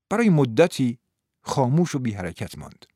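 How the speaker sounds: plain reading voice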